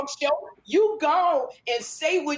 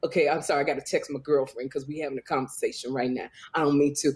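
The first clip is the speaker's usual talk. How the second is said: much lower mock voice of a friend; deep-voiced imitation; 12 semitones lower than usual. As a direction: imitate her with the voice dropped way down. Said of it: "your voice gotta go down real deep".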